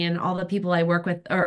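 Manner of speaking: ordinary talk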